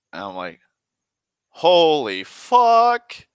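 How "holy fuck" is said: loud, high, drawn-out disbelief; stunned outburst. Retold or imitated. Player reacting to meeting the god of the elves, stunned outburst. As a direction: stretch both words out in loud astonishment.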